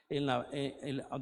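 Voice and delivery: usual speaking voice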